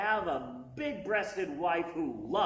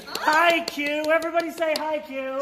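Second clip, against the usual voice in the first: calling out; 9 semitones higher, 9 dB louder than usual. Real, not acted. Calling out to her child filming.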